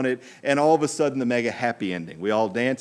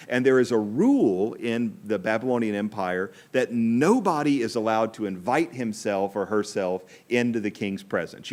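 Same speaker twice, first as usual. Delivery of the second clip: ordinary talk, nothing marked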